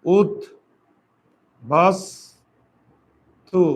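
উদ, বাস, তু with long pauses between; উদ, বাস, তু, keeping a true s in বাস; syllable by syllable. Shown as a demonstration. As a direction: dictate উদ... বাস... তু piece by piece. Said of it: "আর এটা কি হবে".

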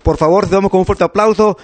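announcer build-up with the name stretched out; big introduction, drawing out the guest's name; show introduction. Real, not acted. Host welcoming guest to applause, announcer build-up.

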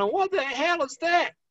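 high, slow, drawn-out cry of disgust; disgusted disbelief. Retold or imitated. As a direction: stretch it out as if the taste still burns. Retold reaction to sour wine.

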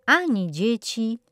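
ordinary delivery, nothing marked

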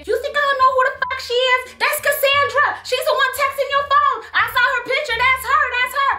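shrill-free but high, hammed-up accusation; mock jealous girlfriend. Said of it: "Tommy goes on to say".

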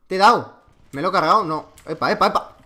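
ordinary talk, nothing marked

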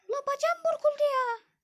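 put-on high girl voice, complaining. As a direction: whine about the twisted leg as a girl. Zeynep has hurt her leg.

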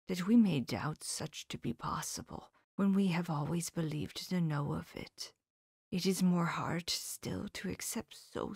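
soft, hushed, gentle whisper; tender. Acted. Professor gently whispering to his grieving friend. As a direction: break the news gently. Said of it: "the tenderness of his face".

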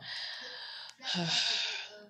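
long breathy exhale; drawn-out role-play sigh; sad sigh. Pretend. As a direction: sigh out, pretending sadness.

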